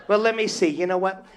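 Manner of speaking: ordinary talk, nothing marked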